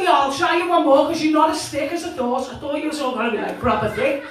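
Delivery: ordinary talk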